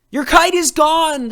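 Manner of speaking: high, loud alarmed cry; the villagers shout in dismay. A narrator's reading. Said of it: "the people cried".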